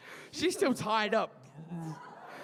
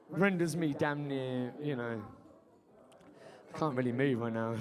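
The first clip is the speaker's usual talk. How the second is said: ordinary talk, nothing marked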